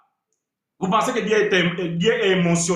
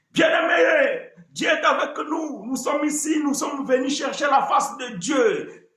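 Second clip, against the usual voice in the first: mock the fiery moderator, drawn out; hammed-up revival preacher; fervent moderator; 6 semitones higher than usual. Preacher mimics moderator's fervor.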